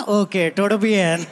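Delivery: put-on Indian-accented Spanish. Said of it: "speaking Spanish in an Indian accent".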